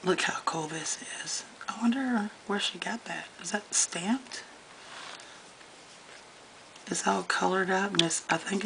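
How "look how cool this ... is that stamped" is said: hushed curious whisper. Real, not acted. Host peering at Katie's page, whispering in wonder. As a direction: whisper in wonder, leaning close.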